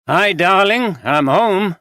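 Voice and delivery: husband calling out as he gets home; called out. Acted. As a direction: call it through the house as he walks in. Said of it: "he called".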